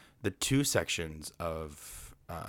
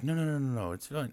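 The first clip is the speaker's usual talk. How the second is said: higher-pitched mild protest, retold; mock scared; 7 semitones higher than usual. Scared Tigger refusing the narrator's help, mock scared. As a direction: lightly imitate a nervous refusal. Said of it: "Tigger's all scared".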